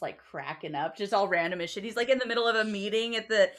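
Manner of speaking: ordinary delivery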